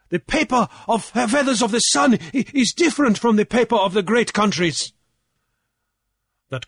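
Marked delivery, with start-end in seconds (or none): 0.1-4.9 high, insistent objection; loud protest. A narrator's reading. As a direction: argue back loudly. Jeremiah protests in the debate.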